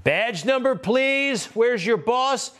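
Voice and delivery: high, mocking protester voice; sneering mimic of a protester; mocking whine. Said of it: "mocking the protesters' demand".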